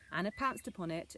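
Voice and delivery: ordinary delivery, nothing marked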